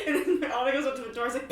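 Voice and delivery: normal talking voice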